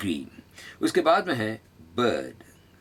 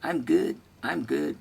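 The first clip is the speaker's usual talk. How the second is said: cocky, higher I am good, tossed off; with attitude; 7 semitones higher, much slower than usual. Shown as a demonstration. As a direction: say it with a bit of swagger. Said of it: "थोड़ा सा attitude". (थोड़े attitude के साथ)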